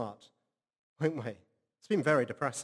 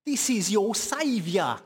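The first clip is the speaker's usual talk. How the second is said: put-on higher, loud shout in a Cambridge accent; proud Cambridge-accented announcement, slow and loud; 6 semitones higher, 5 dB louder, slower than usual. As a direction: bellow it like a Cambridge football manager to the fans. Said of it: "shouted in a very Cambridge accent".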